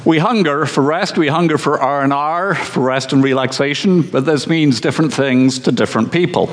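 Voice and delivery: ordinary talk, nothing marked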